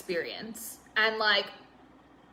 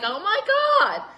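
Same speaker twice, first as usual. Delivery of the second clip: high, drawn-out wail of dismay; hammed-up tearful "oh my God"; mock bawling; 11 semitones higher, 5 dB louder, slower than usual. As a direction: imitate bursting into tears, high and stretched. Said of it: "all of a sudden you're just, like, bawling, crying".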